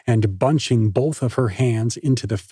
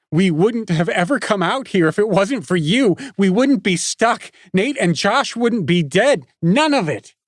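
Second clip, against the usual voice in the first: higher, rising rant; furious blaming; 9 semitones higher than usual. A narrator's reading. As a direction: hurl every accusation at him. Nikki blaming Parker, furious.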